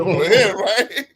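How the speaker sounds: laughing while calling out. Retold or imitated. Joking with friends about a DM, laughing. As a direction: laugh through the quote. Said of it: "I was just like".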